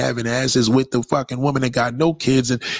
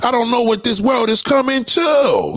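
much higher, slow shouted soul-singer cry; 10 semitones higher than usual. Retold or imitated. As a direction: belt it like a soul preacher on stage. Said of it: "when [name] said".

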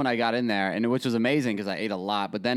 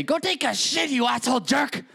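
much higher put-on old Italian grandma; 12 semitones higher than usual. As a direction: squeal it like a cranky old grandma. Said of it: "She'd say".

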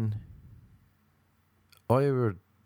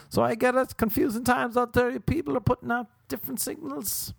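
very high put-on falsetto; high-pitched, monotone falsetto; mock acceptance; 13 semitones higher than usual. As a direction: squeak it out on one high note. Puts on high resigned voice.

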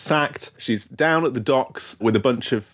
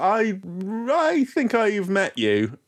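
mock Javert; 9 semitones higher, slower than usual. Imitating Javert.

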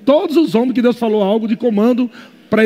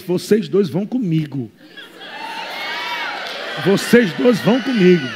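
the spirit speaking; 5 semitones lower, 6 dB quieter than usual. Acted. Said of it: "o teu espírito fala assim".

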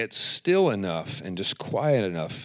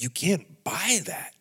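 higher, slow hushed emphasis; whisper; 8 semitones higher, slower than usual. Real, not acted. Telling viewers God's presence is priceless, whispered. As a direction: whisper it like a treasure.

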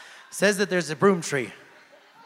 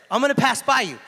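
higher and louder, proclaimed; booming God voice; 8 semitones higher, 9 dB louder, faster than usual. Acted. Preacher retrying God's line to Elijah, loud and proclaiming. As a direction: announce it like the Lord arriving in power. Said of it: "The Lord said".

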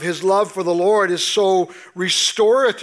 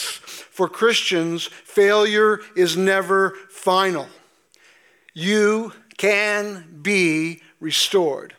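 slow emphatic declaration; slower than usual. Real, not acted. Preacher's key declaration to congregation.

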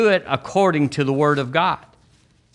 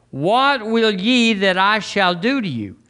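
solemn scripture line; 7 semitones higher, slower than usual. Acted. Jesus' question in the reading.

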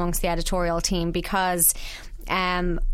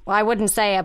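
usual speaking voice

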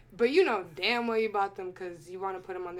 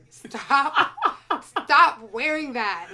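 exasperated loud plea; 4 semitones higher, 11 dB louder, much slower than usual. Real, not acted. Exasperated plea about a fashion trend.

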